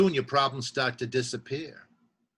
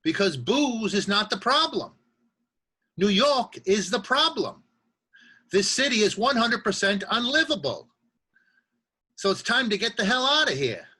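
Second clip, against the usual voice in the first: high-pitched emphatic rant; heated blame; 8 semitones higher, 4 dB louder than usual. Acted. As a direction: rail against the whole city, higher and worked up. Monologue drinker blames the city.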